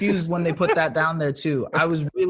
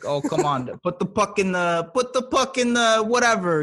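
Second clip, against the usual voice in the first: imitating a high Canadian hockey fan; rising, teasing Canadian hockey yell; Canadian accent; 8 semitones higher than usual. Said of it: "good at the Canadian accent with your hockey thing".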